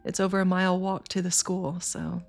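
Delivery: ordinary delivery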